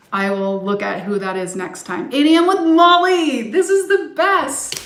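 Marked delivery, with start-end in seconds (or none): 2.1-4.7 high, loud delighted squeal about the class; thrilled fan shout-out; excited gush. Real, not acted. Gushing over Molly's class.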